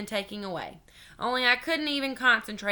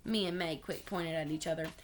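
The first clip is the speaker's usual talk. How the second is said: ordinary delivery, nothing marked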